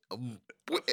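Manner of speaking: normal talking voice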